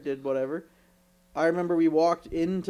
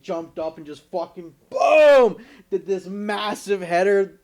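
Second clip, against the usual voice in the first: loud, slow, hammed-up boom; excited shout; 4 semitones higher, 9 dB louder, slower than usual. Telling friends about the header, a loud excited retelling. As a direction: belt out the big header moment.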